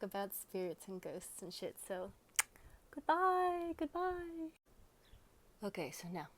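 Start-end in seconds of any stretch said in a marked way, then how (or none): 2.9-4.5 high, drawn-out playful send-off; sing-song goodbye. Pretend. Waving off viewers who aren't spiritual, high sing-song dismissal. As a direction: chirp a farewell, held on a high note.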